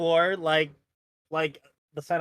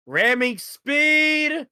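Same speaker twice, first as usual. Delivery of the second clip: higher, slow drawn out war cry; battle cry; 10 semitones higher, 5 dB louder, much slower than usual. Acted. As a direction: charge forward yelling.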